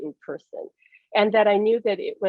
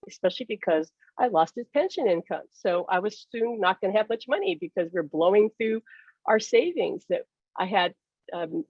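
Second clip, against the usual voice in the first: ordinary delivery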